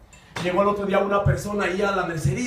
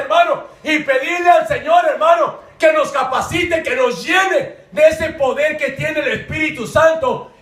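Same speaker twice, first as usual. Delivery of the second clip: raised, forceful sermon voice; loud, higher-pitched exhortation; fervent preaching; 7 semitones higher, 6 dB louder than usual. Real, not acted. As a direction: preach it out to the congregation.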